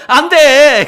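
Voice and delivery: high, loud "no!" cry; panicked shout. Acted. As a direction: yell it in sudden alarm. Man panics at friend getting reward. (다급하게 외치는 "안 돼!")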